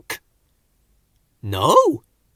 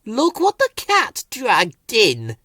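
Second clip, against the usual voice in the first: high, slow sneering voice; sour; 9 semitones higher, slower than usual. A narrator's reading. Thistle sneering at Bill and Ben, sour and slow. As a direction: curl the lip at them. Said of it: "observed Thistle sourly".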